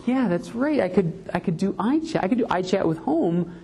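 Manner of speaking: light up at the idea; mock-breathless excitement; eager realization. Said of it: "I'm like".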